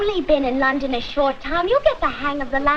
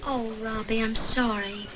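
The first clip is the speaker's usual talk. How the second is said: soft, low condolence; sympathetic; 4 semitones lower, 8 dB quieter than usual. Acted. Girl consoles Robbie over his failed audition, softly sympathetic. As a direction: lower your voice and console gently.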